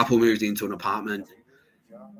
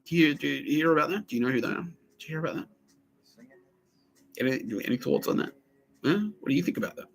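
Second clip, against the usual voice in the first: lightly voiced prying policeman, higher; imitated cop; 7 semitones higher than usual. Police officer questioning the neighbor, lightly imitated, higher. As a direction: needle him like a suspicious cop. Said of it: "He's like".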